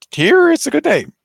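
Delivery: very high, loud character voice; acted reply. Shelter talk about the pet's name, high and loud. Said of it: "like, saying".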